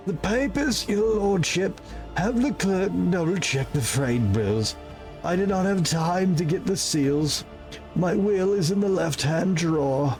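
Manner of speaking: high, earnest servant voice; dying servant. Acted. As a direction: loyal servant with last orders.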